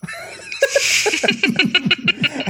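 high burst of laughter. Real, not acted. Laughing at script mixup.

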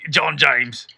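slow, put-on Australian accent; mock Australian. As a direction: play it as an Aussie. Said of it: "your Australian accent".

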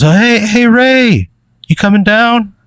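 very high, timid little voice; timid character. Acted. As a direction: call up timidly in a high put-on voice. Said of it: "He's very timid".

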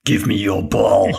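slow menacing villain line; lightly voiced bully demanding the ball; slow theatrical villain menace. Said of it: "Jim was the voice, the voice of a villain".

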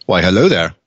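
lightly voiced Obi-Wan Kenobi; a hint of a wise old Jedi; Obi-Wan impression. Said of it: "gotten really good at that impression".

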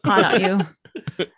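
slow, teasing vintage purr; Katharine Hepburn voice. Pretend. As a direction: coax him closer, old Hollywood style. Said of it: "Katie from the Philadelphia Story".

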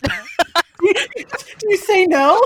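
laughing disbelief. Real, not acted. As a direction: ask it through laughter. Incredulous laughing question.

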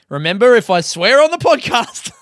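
high, loud American announcer parody; American accent. Pretend. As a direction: put on a hammed-up American accent. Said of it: "did that in an American accent".

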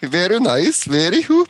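high, bouncy Swedish caricature; mock Swedish accent. Shown as a demonstration. Streamer clowning for friends, mock Swedish accent. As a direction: swing the pitch up in fake Swedish. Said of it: "Swedish accent sound like".

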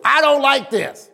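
mock child's complaint, pitched high; complaining child. Child to parents at the dinner table, imitated complaint. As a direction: imitate a kid balking at dinner. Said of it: "first thing they used to say was".

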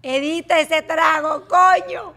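loud, high exasperated mock order; retold shouted order. Host re-enacting her order to the editor, exasperated shout. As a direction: shout it as a worked-up complaint. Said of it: "Y le dije a Franklin".

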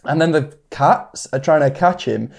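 ordinary talk

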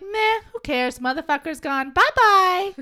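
high, loud flippant villain; dismissive high voice. Retold or imitated. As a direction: toss it off carelessly. Sebastian quoted dismissively.

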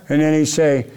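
ordinary delivery

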